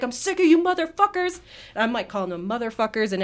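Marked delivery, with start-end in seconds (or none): 0.0-1.4 high retold rant. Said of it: "I was like".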